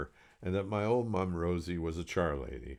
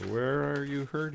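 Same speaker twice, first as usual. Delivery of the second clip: higher doctor's question, stress on hurt; doctor asking again; 8 semitones higher, 3 dB louder than usual. Acted. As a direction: lean on the word hurt. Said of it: "he asked me".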